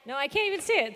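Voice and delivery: ordinary talk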